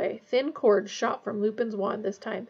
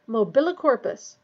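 ordinary delivery, nothing marked

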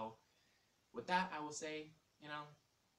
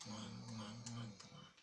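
smooching kiss sounds; 2 semitones lower, 5 dB quieter, slower than usual. Acted. Blowing kisses at viewers.

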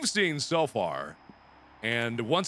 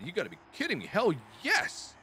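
ordinary delivery

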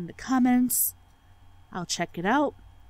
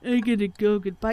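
mocking her exit. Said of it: "You're always the one that's like".

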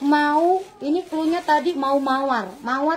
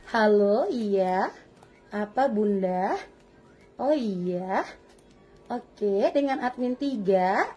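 lower, softer, slow bored phone voice; lazy admin three; 5 semitones lower, 4 dB quieter, much slower than usual. Retold or imitated. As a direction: drag it out like an uninterested admin. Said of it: "nadanya seperti ini".

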